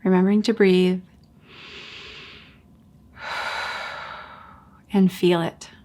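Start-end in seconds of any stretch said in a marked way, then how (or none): 3.2-4.1 soft, airy breath out; audible exhale. Shown as a demonstration. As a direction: release a quiet sighing breath. Models breathing out in meditation.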